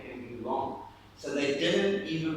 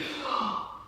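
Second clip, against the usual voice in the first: sharp high first gasp of a newborn; newborn's gasp; 8 semitones higher than usual. Retold or imitated. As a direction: gasp like a baby taking its first breath. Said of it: "you went".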